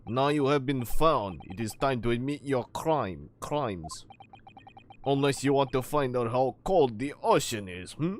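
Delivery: threatening Russian sailor voice; Russian accent. Acted. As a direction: press for a confession in a Russian accent. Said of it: "it's the Russian, other Russian sailor".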